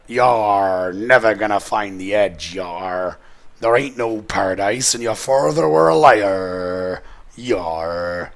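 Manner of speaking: slow, growly pirate threat ending in "yar". Acted. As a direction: ham up a pirate captain taunting. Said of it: "You like my vi-, pirate voice?".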